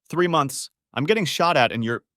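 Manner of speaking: high, loud bitter outburst; angry shout. Acted. Husband shouting at his wife about the months, angry. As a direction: spit the words out in fury.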